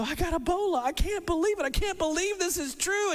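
high, stunned disbelief; patient just told bad news, in shocked disbelief. Patient's shocked reaction voiced.

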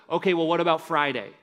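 shaming jab. Retold or imitated. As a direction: throw his past back at him. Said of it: "If Jesus wanted to shame Peter".

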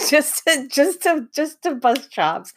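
giggling through the words, high; words squeezed out through laughter; breaking up laughing mid-sentence. Real, not acted. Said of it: "I can't even talk straight".

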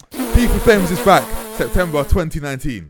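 slow, loud promo announcement; announcer voice. Acted. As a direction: announce it like a trailer. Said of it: "Let me start again".

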